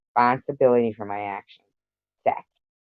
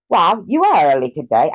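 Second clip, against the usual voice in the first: higher, louder attempt at an Australian accent; failed Australian; 4 semitones higher, 4 dB louder than usual. Streamer greeting a viewer in a failed Australian accent. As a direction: try an Australian accent and miss. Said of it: "I can't do Australian".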